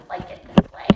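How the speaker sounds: put-on stubborn kid, whispered; whispered defiance. A boy whispering back at his mother about sugar. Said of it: "I said".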